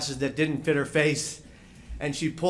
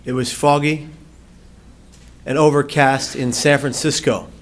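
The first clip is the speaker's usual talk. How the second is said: loud, intense opening, slowing down at the end; projected speech-opening delivery; dramatic storytelling; 9 dB louder than usual. Acted.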